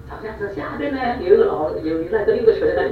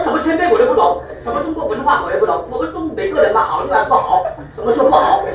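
higher, laughing, hammed-up retort; wife scolding her husband, higher and half laughing; mock scolding wife; 8 semitones higher than usual.

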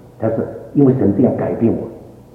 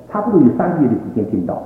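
ordinary talk, nothing marked

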